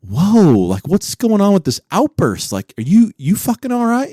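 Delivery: taken aback, pitch climbing into the question; concerned. Retold or imitated. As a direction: act out checking on an upset buddy. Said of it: "we all kinda got concerned".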